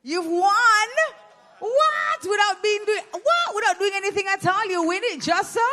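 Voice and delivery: high, loud disbelief at a free win; surprised. Real, not acted. Host amazed the guest won without doing anything, loud high disbelief. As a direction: jump way up in pitch, amazed.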